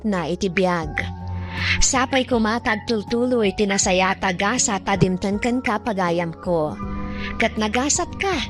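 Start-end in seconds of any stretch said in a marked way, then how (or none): none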